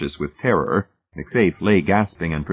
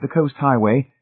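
plain reading voice

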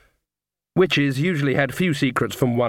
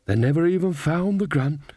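ordinary delivery

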